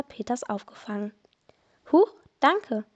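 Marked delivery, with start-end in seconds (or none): 1.9-2.8 mild startled yelp, higher and louder; a small jolt of surprise, then thanks. A narrator's reading. Kim slips and is caught by the commissioner, mildly startled. Caught after slipping on ice.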